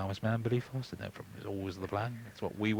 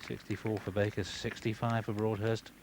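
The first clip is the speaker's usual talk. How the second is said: ordinary delivery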